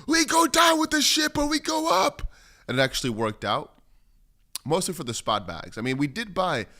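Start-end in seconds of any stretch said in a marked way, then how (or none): 0.1-2.3 much higher, loud, slow battle cry; rally the troops as the ship goes down; hammed-up slow war cry to the chat; rallying shout. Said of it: "And we were saying".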